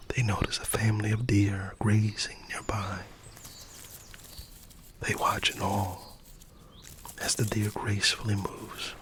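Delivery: soft unvoiced storytelling whisper; ASMR bedtime story whisper; whispered narration. A narrator's reading. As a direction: breathe the story out gently.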